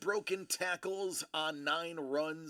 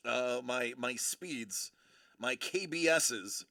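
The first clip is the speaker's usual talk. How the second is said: ordinary delivery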